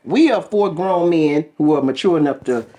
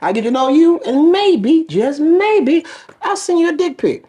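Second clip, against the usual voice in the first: mock address; 8 semitones higher than usual. Host teasing women listeners with a mock pitch, rising playfully. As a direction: build a fake-sincere speech to a comic payoff.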